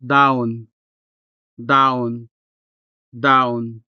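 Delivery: dahon as "Down", h dropped; disappearing h. Shown as a demonstration. Tutor says listen closely, casual dahon as "Down" with disappearing h. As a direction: let the h vanish in "Down", stress first. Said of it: "sounds weak, if not quite disappearing".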